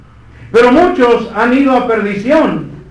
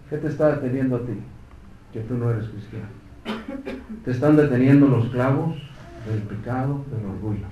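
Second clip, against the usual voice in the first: much softer, lower, intimate; quiet appeal; 8 semitones lower, 9 dB quieter than usual. Real, not acted. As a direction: lean in and drop the voice. Sudden drop to intimate address.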